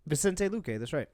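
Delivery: ordinary talk, nothing marked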